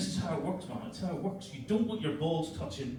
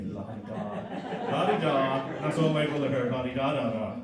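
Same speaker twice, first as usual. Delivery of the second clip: mock posh; 4 semitones lower than usual. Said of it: "mocking posh voice".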